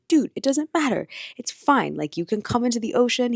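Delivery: put-on brash New York accent, tossed off. Surf coach reassuring her in a mock New York accent. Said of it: "giving him a New York accent".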